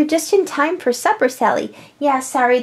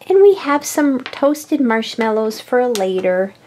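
usual speaking voice

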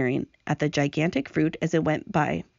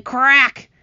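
loud, sudden crack; cracking sound effect; 6 semitones higher, 8 dB louder than usual. A narrator's reading. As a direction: make the word sound like an impact. Imitates hailstone hitting the centipede.

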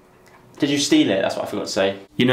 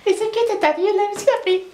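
much higher, fast squeaky kid imitation; mock child voice; faster than usual. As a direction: mimic the little girl teasingly. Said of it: "that's what a kid would say".

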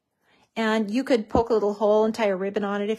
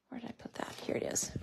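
a hushed mumble under your breath; whispered aside; 14 dB quieter, faster than usual. Real, not acted. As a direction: whisper it to yourself while searching. Searching for foam dot aside.

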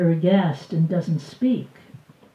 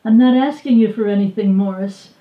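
defiant; 3 semitones higher, 8 dB louder, faster than usual. Acted. Defiant reply to him.

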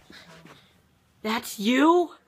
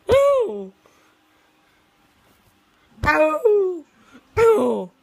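ordinary talk

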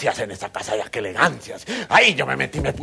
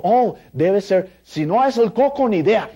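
slow high guessing doctor; 7 semitones higher, 5 dB louder, slower than usual. Retold or imitated. El doctor adivina, lento y alto.

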